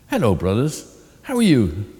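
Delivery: high, bright bird voice boasting; old crow voice. A narrator's reading. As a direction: lift it up like a proud crow.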